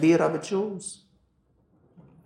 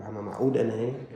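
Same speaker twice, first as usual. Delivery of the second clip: muttering to himself; 4 semitones lower, 5 dB quieter than usual. Retold or imitated. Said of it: "بيقول".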